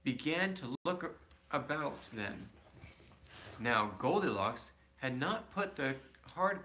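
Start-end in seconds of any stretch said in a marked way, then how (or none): none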